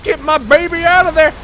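high, loud cry of alarm; urgent yell. Real, not acted. Player calling for his baby's rescue, urgent yell. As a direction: shout to rescue someone.

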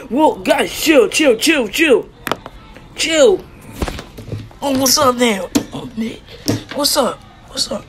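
high-pitched, loud bursts; rapid yells into a brawl; scuffle shouting. Acted. Wrestlers squaring up while told to chill, excited shouting.

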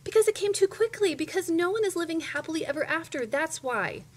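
high, loud insistent protest; indignant child. A narrator's reading. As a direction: argue back like an outraged child. Indignant child argues back.

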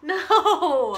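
high, loud laughing "no"; loud laughing protest. Real, not acted. Girlfriend objects to his joke birthplace, loud laughing protest. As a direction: cry out in amused protest. Protests partner's joking answer.

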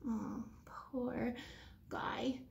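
soft mock pity whisper; whispered teasing. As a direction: breathe it out as a secret.

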